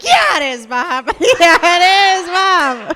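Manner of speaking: loud, high, hammed-up ecstatic agreement; mock aroused yelling. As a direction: cry out to Bob in exaggerated delight. Acts out aroused viewer answering Bob.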